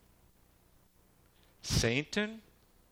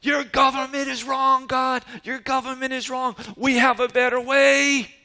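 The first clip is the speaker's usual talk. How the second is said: high, shouting voice of Satan's angels; high mock shout of defiance; shouting rebels; 9 semitones higher, 3 dB louder than usual. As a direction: yell it at God as a rebel mob. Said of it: "shouting at the top of their lungs".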